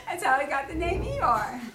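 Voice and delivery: laughing through the line. Real, not acted. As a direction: chuckle after the donkey noise. Laughs at own bray.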